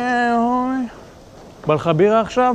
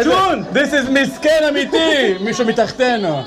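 silly high voice; 9 semitones higher than usual. Pretend. Introduces bird in silly voice.

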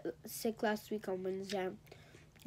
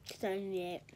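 ordinary delivery

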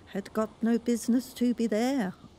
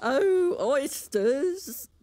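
high, louder call to the oysters; carpenter calling; 9 semitones higher, 4 dB louder, much slower than usual. A narrator's reading. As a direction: call out across the beach. Carpenter calls.

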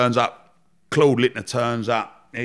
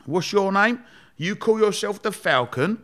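higher-pitched, drawn-out mocking challenge; slating boss; 9 semitones higher, slower than usual. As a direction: put them down with pointed, raised questions. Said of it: "Lord Sugar just started slating everyone".

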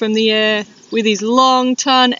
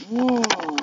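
usual speaking voice

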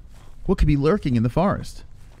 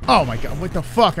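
startled; 5 semitones higher, 3 dB louder than usual. Real, not acted. Startled by a noise in a horror game.

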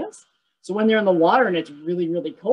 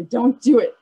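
don't do it said louder, a playful firm warning; 5 semitones higher, 7 dB louder than usual. Real, not acted. Teacher warning kids not to poke the bear, firm and louder. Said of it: "I know you want to".

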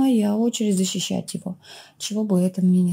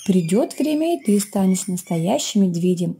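plain reading voice

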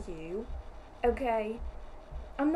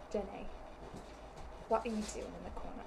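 ordinary talk, nothing marked

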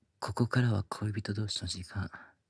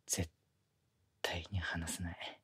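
slow possessive whisper; 4 semitones lower, 8 dB quieter, slower than usual. Acted. Junior whispering his vow never to let go. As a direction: vow it softly in her ear.

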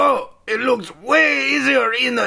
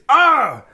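pained yelp; 4 semitones higher than usual. Acted. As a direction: cry out at the sudden brain freeze. Brain freeze pain.

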